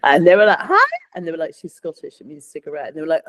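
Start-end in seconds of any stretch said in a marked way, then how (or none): 0.6-0.9 very high mock astonishment; high, exclaimed disbelief; startled reaction. Imitating startled Americans' reaction. 1.5-2.9 very soft, confidential aside; hushed, as if behind someone's back; hushed explanation. Retold or imitated. Onlookers explaining among themselves.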